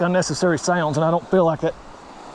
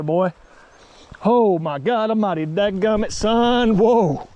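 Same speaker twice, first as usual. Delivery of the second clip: ordinary delivery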